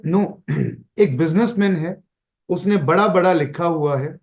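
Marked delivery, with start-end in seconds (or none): none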